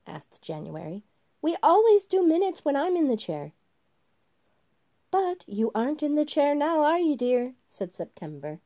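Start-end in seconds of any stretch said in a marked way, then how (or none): 1.4-3.5 much higher and louder insistence; fussy January. Acted. January demands the minutes, high and loud. 5.1-7.5 higher, sweetly condescending; mock solicitude. September sweetly puts January down, higher and louder. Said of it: "an elegant creature of mock solicitude".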